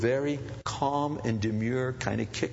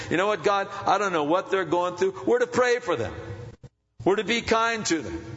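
higher, louder pleading build; rising preacher's cry; 8 semitones higher, 4 dB louder than usual. Acted. Pastor urging the church to be kind, loud. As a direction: lift the voice to heaven.

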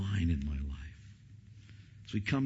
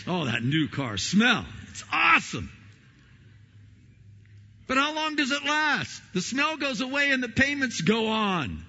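mock rapture; 13 semitones higher, 7 dB louder than usual. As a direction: gush over the car, then drag out the payments. Mocking new car thrill.

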